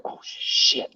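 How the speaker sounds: hushed, whispered curse; retold whispered realisation; dawning dread. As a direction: breathe it out as it sinks in. Retold realisation of being illegal.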